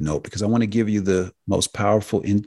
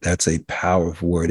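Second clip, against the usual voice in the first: ordinary talk, nothing marked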